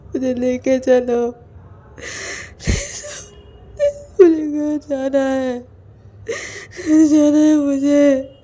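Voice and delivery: loud, slow tearful begging to go home; sobbing plea. Acted. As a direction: cry and beg, voice breaking. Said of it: "बहुत ज़ोर ज़ोर से रोने लगता है".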